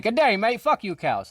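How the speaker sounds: loud, high, hammed-up Australian "Good day, mate"; a kangaroo doing a cheesy Aussie greeting, badly faked; bad Australian accent. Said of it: "bad impression".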